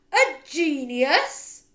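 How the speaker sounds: very loud, slow, high shout; outraged shout. Acted. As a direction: explode with disbelief. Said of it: "she shouted".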